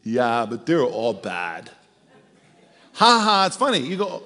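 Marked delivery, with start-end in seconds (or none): 0.1-1.7 lower, slow, matter-of-fact; casual tough guy. Retold or imitated. Spy on truth serum answering his wife, offhand and low. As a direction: shrug it off like it's nothing.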